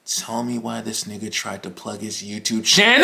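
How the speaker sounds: low, soft monotone exploding on "channel"; deadpan into scream. Real, not acted. Telling viewers of classmate's plug, deadpan breaking into scream. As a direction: hold it low and quiet, then erupt on the last word.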